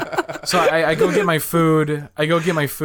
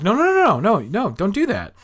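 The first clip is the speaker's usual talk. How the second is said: retold hasty objection; 7 semitones higher than usual.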